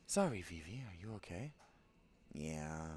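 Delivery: ordinary talk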